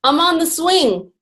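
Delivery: acted drawn-out holler; calling back. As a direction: call back slowly as if from afar. Drunk man calls back, punchline.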